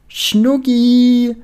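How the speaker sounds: high, loud, drawn-out pet-name holler. A narrator's reading. Penelope running towards Lukas, very loud call. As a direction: sing it out loudly to your sweetheart. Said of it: "ruft sie noch lauter".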